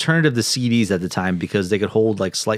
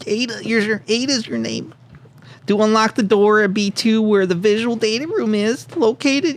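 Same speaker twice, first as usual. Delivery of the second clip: nerdy scientist voice; 10 semitones higher than usual. Acted. Keeps reading letter in voice.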